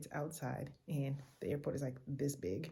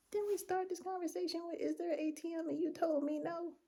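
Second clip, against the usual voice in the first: much higher, disbelieving squeak; can you believe this, pitched way up; incredulous; 14 semitones higher than usual. Acted. Tourist reminds staff they said no, high incredulous.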